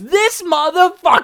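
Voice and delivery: loud high angry outburst; furious shout. Retold or imitated. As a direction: yell it in outrage. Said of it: "You were just like".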